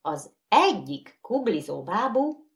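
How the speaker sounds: usual speaking voice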